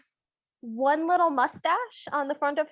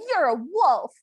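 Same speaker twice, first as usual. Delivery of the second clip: loud, emphatic accusation; mock yell; 6 dB louder than usual. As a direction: imitate a yelled accusation. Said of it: "and yells".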